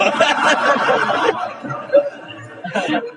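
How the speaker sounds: long high cackling laugh; high unvoiced giggling fit; high laughter. Real, not acted. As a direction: burst out laughing at the joke. Preacher laughs at his story.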